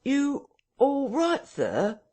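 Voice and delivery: very high, slow, insistent call; sharp query. A narrator's reading. Landlord asks again through the parlour door, sharply. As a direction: call it sharply, stretching each word high. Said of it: "asked Mister Hall sharply".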